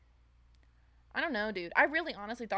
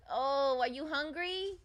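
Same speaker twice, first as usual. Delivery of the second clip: higher, slow sing-song; 5 semitones higher than usual. Real, not acted. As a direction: coo at the hungry dog. Baby talk to the dog.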